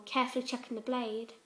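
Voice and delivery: ordinary delivery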